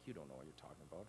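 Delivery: bitter under-the-breath retort; hurt mutter. Retold or imitated. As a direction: grumble it under your breath.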